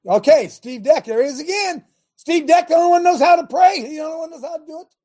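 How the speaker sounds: high, loud, fast hailing of the volunteer; excited praise. Real, not acted. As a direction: cheer him on to the room. Pastor hails volunteer with excitement.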